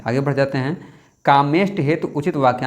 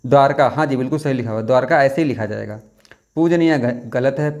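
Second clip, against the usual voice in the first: ordinary talk, nothing marked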